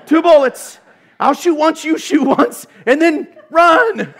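high, loud, laughing through a silly battle plan; cracking up as the panicky soldier; giggling soldier hamming up two bullets; laughing mock army plan. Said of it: "be like".

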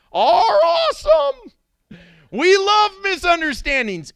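sarcastically oversell it; mock excitement. Sarcastic exclamation.

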